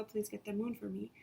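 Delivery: ordinary delivery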